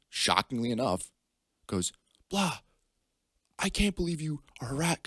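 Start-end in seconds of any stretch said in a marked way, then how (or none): 2.3-5.0 high-pitched mock telling-off; scolding dad impression. Dad confronting his son over harassment, high mock scolding. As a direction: imitate an outraged parent laying into a kid.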